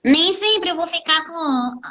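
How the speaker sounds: quick nasal twang on the line. Shown as a demonstration. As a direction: push the air through the nose. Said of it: "tenho que fazer uma voz fanha". (voz fanha)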